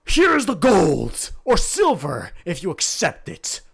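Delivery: big earnest himbo, loud and proud; dopey sweet himbo. Acted. Bang pays.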